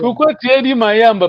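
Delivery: pourquoi lancé fort et haut; question appuyée. Real, not acted. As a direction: interpelle l'élève avec insistance. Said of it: "professeur interpelle l'élève". (reprend l'élève d'une voix forte)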